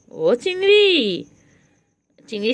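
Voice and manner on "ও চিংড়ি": calling the cat, much higher and louder. Real, not acted. Owner calling out to her cat across the room, high and loud. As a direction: sing out the pet name.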